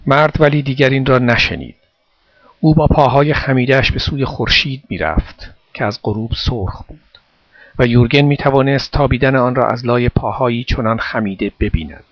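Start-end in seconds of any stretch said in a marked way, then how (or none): none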